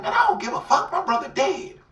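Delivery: high, loud, laughing despair; anguished yell. Retold or imitated. Yelling at his sister that his brother is dead, raw. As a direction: blurt it out raw. Snapping at sister while crying.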